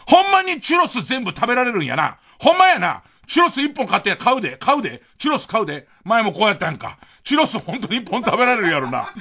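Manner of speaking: playing a Kansai mom nagging her kid over churros; imitating a Kansai mom scolding. Retelling a Kansai mother at Disneyland scolding her child, in a mock-scolding Kansai accent. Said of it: "関西弁のお母さんが子供を怒鳴ってる".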